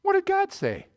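high, sly hissing tempter asking; the serpent slyly questioning Eve, pitched high. Acted. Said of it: "Comes unto Eve and says".

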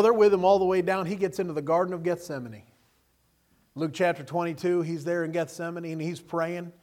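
normal talking voice

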